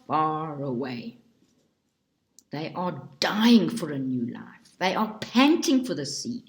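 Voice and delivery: ordinary delivery, nothing marked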